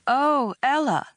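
slow, high, overdone dismay; badly faked horror. A narrator's reading. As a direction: act horrified and fail at it. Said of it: "supposed to be a horrified tone".

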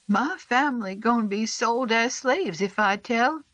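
put-on high, scared little boy; frightened boy confessing; young boy voice. A narrator's reading. Narrator voices the boy Sam.

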